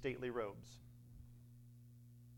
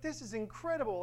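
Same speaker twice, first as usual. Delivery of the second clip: mock amazed guests; 8 semitones higher than usual. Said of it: "everybody else is like".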